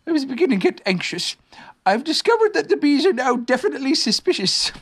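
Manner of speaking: high-pitched, worried bear; Pooh bear, anxious. Acted. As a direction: report trouble in a high bear voice.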